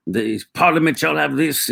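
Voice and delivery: put-on high, declamatory British accent; strong British accent. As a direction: proclaim it like a British period-film statesman. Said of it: "strong voices and British accents".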